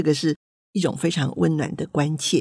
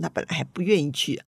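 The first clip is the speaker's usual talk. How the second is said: usual speaking voice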